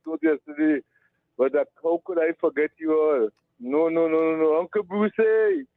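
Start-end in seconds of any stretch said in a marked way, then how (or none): 5.2-5.6 sudden high yelp of hey; excited hey. Real, not acted. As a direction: call out hey with joy. Greeting Uncle Bruce on air.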